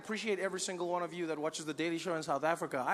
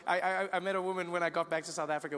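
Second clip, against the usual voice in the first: ordinary talk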